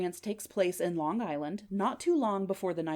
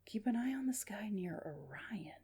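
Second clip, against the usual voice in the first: whispered musing; hushed, questioning self-talk; pondering; 10 dB quieter than usual. Acted. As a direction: puzzle it out under the breath. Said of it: "He's pondering".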